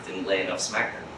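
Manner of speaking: low, soft muttered line; hushed aside. Shown as a demonstration. Teacher quietly confiding the priest's failing. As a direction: drop the voice, almost private. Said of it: "repeated quiet punchline".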